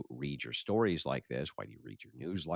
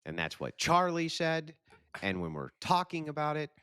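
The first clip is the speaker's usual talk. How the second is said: exaggerated rising tune, pitched far higher; mock upspeak; 13 semitones higher, 3 dB louder than usual. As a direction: parody the speaker's up-talk with big climbing ends. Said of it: "the upspeak at the end of every single period".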